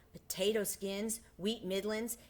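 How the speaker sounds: ordinary delivery, nothing marked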